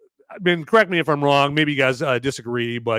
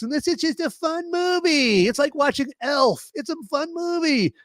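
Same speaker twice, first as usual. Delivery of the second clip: high, sing-song mock enthusiasm; mock cheery fan; 13 semitones higher than usual. Mocking the other side, high and sing-song. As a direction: parody a gushing fan.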